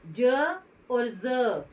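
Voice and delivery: very slow ज then ज़, the dotted one said as a buzzing z; ज और ज़ का धीमा अंतर; contrast demo. Shown as a demonstration. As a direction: draw out ज and ज़ slowly so the dot's z sound is heard. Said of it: "इसका pronunciation हो गया ज".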